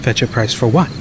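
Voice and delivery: ordinary delivery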